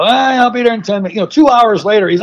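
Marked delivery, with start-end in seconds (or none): 0.0-1.1 quick, higher mock promise; breezy brush-off. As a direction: toss it off fast, imitating a late friend. Retold friend promising a ride.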